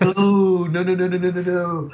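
high, loud "oh" into rapid noes; hammed-up alarm, pitch held way up; mock panicked denial. Customer fears it went on his credit, in mock panic.

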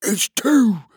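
stubborn little creature voice; high husband voice. Acted. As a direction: insist back in a high squeak. Husband character voice.

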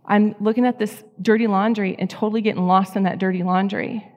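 usual speaking voice

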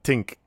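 "Tink" with a hard t in place of th; imitated Irish think. Host echoing a guest's Irish accent, "Tink" with a hard t. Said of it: "I like that".

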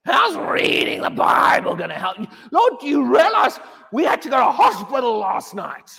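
loud, high mock cry to the Lord; mock desperate plea. Preacher mimicking frantic believers, loud pleading cry.

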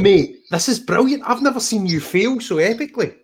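high-pitched, gleeful ribbing; delighted teasing. Real, not acted. Host mocking guest's failed accent attempt, delighted teasing. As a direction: crow over a mate's flop.

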